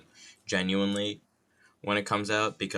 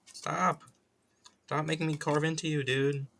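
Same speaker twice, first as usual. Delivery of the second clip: high exasperated pleading; 8 semitones higher than usual. Real, not acted. Scolding object while carving.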